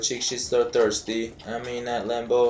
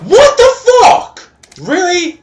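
very high, loud, drawn-out disbelief; shocked yell; 13 dB louder than usual. Real, not acted. Gamer reacts to a bad moment, shocked yell. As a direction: shout in outraged astonishment.

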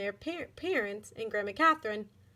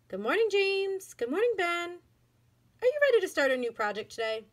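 a lightly voiced teacher, higher and bright; cheerful teacher; 7 semitones higher, 4 dB louder than usual. A narrator's reading. Teacher greeting her pupils, lightly voiced higher. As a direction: greet each child like a kind teacher.

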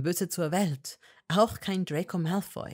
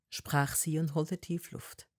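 usual speaking voice

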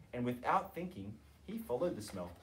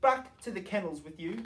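higher, louder ladies ordering him off; scolding; 5 semitones higher, 4 dB louder than usual. A narrator's reading. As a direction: shoo the dog away.

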